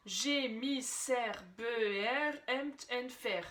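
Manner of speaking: "j'ai mis Cerbère, empt, ent, fer" drawn out syllable by syllable in French; read the French way. Shown as a demonstration. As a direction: sound out "Cerbère" slowly as separate French syllables. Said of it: "si on le lit à la française".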